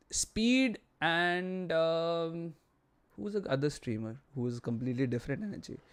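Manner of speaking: normal talking voice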